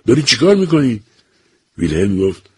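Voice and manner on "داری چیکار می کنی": high, loud cry of alarm; old father shouting at his son choking himself. A narrator's reading. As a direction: shout at him to stop. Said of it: "پیرمرد فریاد زد".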